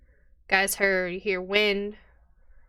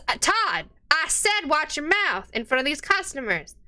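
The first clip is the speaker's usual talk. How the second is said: higher, scolding barmaid voice; scolding wife; 7 semitones higher than usual. Acted. Wife scolds husband before the customers, pitched up. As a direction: tell him off in front of guests.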